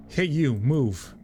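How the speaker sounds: mildly angry, drawn-out order; acted irritation, slowly ordering someone aside. A narrator's reading. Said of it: "I hear an angry voice".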